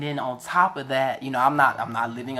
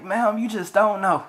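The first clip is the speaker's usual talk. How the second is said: tearful; 5 semitones higher than usual. Retold or imitated. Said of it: "I just started crying on the phone".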